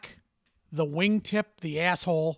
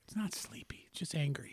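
a soft, unvoiced aside; whispered correction; 10 dB quieter than usual. Real, not acted. Correcting his co-host about his dad's face, whispered. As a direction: whisper it like a secret joke.

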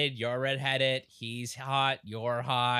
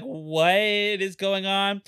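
stunned and slow; 2 semitones higher, much slower than usual. Retold or imitated. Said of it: "I was like".

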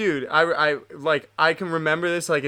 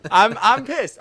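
louder, higher, half-joking anger; real heated outburst; 5 semitones higher, 7 dB louder than usual. Real, not acted. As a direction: blurt it out hot and loud. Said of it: "I'm heated".

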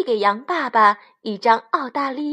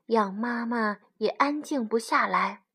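ordinary delivery

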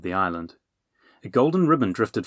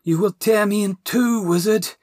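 gagging; 12 semitones higher, 6 dB louder than usual. Acted. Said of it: "the dragon gagged".

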